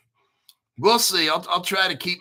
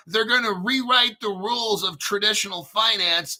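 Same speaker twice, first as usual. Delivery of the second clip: ordinary delivery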